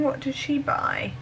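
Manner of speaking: whispered, curious aside; hushed whisper. Real, not acted. Vlogger peeking at what a fan bought, whispering. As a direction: whisper it like snooping.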